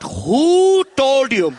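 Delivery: slow menacing demand. Retold or imitated. Mom confronting her kid at the sprinklers, slow menacing demand. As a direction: drag it out like a threat. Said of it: "She's like".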